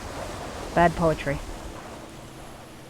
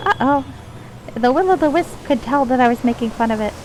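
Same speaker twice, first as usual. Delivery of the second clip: high pixie aside; a worried uh-oh pitched up like a pixie; 6 semitones higher than usual. Acted. Player caught making fun of the wisp, high pixie voice. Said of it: "pixie voice when I'm not in character".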